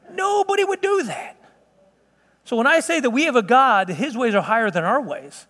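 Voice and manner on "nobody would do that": high, incredulous exclamation; high-pitched disbelief. Real, not acted. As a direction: voice leaps way up, then drops at the end. Exclaims at the absurd idea.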